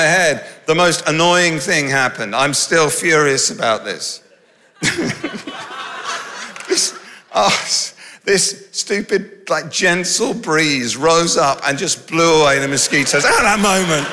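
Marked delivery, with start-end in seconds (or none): 4.8-9.5 high breathy laugh breaking into words; can't get the words out for laughing. Real, not acted. Preacher cracking up retelling the breeze, laughing.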